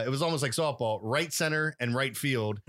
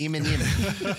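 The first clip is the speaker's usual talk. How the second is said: Australian accent; 4 semitones higher, 3 dB louder, much slower than usual. Shown as a demonstration. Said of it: "I say it in the Australian accent".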